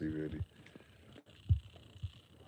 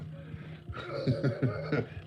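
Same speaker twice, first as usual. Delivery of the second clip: breathy laugh; 5 semitones higher than usual. Real, not acted. Streamer mocking opponents in chat, breathy laugh. Laughing at his own jibe.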